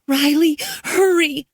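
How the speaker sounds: put-on a scared girl begging for help; voice cracking with fear. A narrator's reading. Girl begs Riley on the phone, voice cracking with fear. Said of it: "Hannah's voice cracked with fear".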